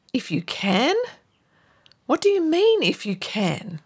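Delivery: Jesus's question, pitch jumping up, then dropping to a whisper; a touch of hurt disbelief; sounding hurt. Retold or imitated. Preacher voicing Jesus answering the father, mildly hurt. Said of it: "almost sounding hurt".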